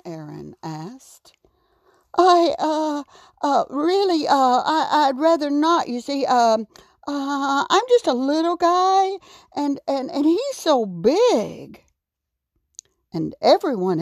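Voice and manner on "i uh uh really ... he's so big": high, halting little character voice; timid excuses. A narrator's reading. Raccoon begs off meeting the giant, nervous and hesitant. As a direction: stall nervously, pitched up, stumbling over the excuses.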